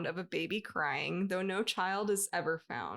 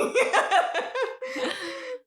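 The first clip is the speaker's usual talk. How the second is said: high laugh; 6 dB louder than usual. Real, not acted. Long laugh.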